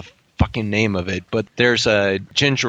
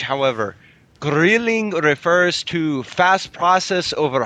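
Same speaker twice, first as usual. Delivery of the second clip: high-pitched put-on Scottish-Irish brogue; Celtic accent lifted well above natural pitch; 5 semitones higher than usual. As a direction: read formally in a muddled Irish-Scottish accent. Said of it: "That was very Scottish".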